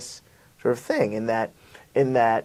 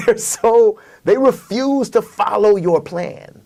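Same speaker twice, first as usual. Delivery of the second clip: laughing exasperation; 10 semitones higher, 8 dB louder, slower than usual. Real, not acted. Laughing complaint about audiences.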